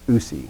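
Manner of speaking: "usi" dropped soft and low, no stress; soft, low, stressless "usi"; unaccented ending. Shown as a demonstration. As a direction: let "usi" fall away unaccented. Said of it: "which is unaccented".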